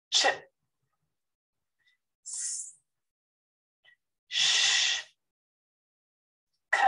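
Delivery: each consonant said alone and crisply, with pauses; consonant sounds demo. Shown as a demonstration. Teacher modelling the consonant sounds one by one. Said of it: "The top row are unvoiced consonant sounds".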